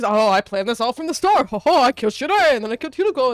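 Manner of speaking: loud, hammed-up gloating; mocking villain boast. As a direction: crow the evil plan sarcastically.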